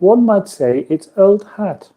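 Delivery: high, slow, prim delivery; impression of Emma. Host mimicking a viewer's comment, high and slow. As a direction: Emma trying not to be too posh. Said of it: "an impression of you saying that".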